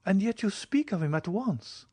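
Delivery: put-on quick, high young woman's question; pressing question. Julie presses the count quickly, high questioning voice. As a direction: fire it back fast.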